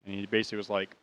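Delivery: ordinary delivery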